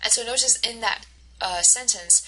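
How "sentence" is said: normal talking voice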